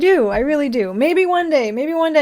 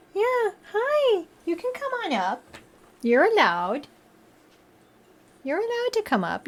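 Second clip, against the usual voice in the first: coaxing pet voice; 7 semitones higher, 6 dB quieter than usual. Real, not acted. Coaxing the cat up.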